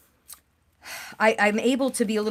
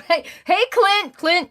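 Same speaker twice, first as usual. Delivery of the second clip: calling out; 10 semitones higher, 7 dB louder, slower than usual. Real, not acted. Greeting a viewer in chat.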